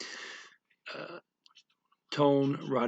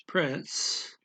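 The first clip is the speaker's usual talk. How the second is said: normal talking voice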